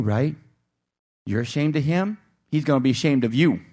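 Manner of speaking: usual speaking voice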